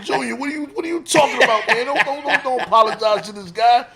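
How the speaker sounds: high, loud, fast yelling at his son; exasperated shout. Retold or imitated. Father yelling at son to stop apologizing, loud disbelief. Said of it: "I'm like".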